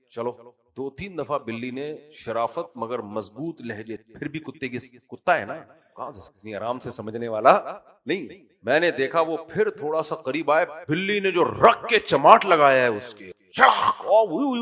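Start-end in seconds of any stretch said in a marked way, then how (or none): 0.1-0.5 soft, steady cat warning, mildly imitated; calm firm warning. Cat calmly warns the dog off its meat, soft and firm. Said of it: "ایسے آرام آرام سے بلی کہہ رہی ہے". 13.5-14.1 slap sound effect. Retold or imitated. Cat slaps the dog. 14.1-14.6 high mock yelps of a slapped dog; dog's yelp. Slapped dog yelps as it runs off, high mock yelping. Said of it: "اوئی کر کے بھاگا ہے وہ".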